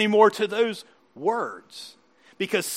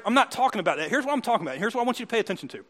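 ordinary delivery

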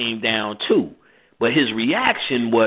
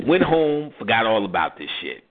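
ordinary delivery

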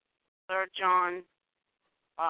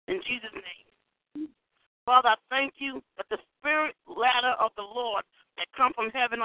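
praying; 3 semitones higher, 4 dB louder than usual. Acted. Leads a decree prayer.

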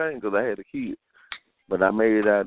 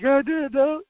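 mock surprised complaint; 12 semitones higher than usual. Retelling the workers' surprised complaint.

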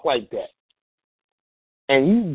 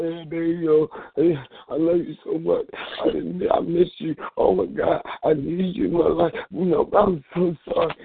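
higher, hammed-up tearful pleading; fake tears; 4 semitones higher than usual. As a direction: put on a mock sob to win her back. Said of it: "putting on the fake tears out".

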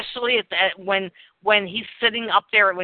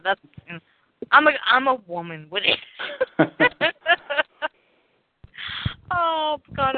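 ordinary talk, nothing marked

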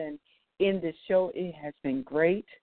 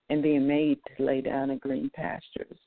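ordinary talk, nothing marked

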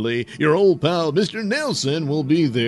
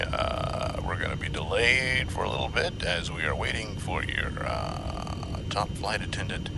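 soft, low pilot cabin announcement; airline captain announcement; 6 semitones lower, 11 dB quieter than usual. Acted. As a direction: speak like a pilot over the intercom. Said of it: "this is your captain speaking".

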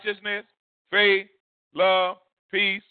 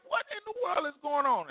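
retold astonishment; 9 semitones higher, 8 dB quieter than usual. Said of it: "I was like".

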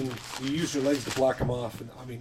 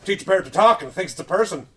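pompous bigot voice; 5 semitones higher, 6 dB louder than usual. Acted. Sexist NPC rant.

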